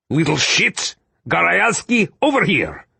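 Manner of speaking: distant soldiers' rough shout; yelling across the battle. A narrator's reading. Gorozny fighter yelling to his men, shouting. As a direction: call the comrades over through the chaos. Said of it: "The Gorozny guys were yelling".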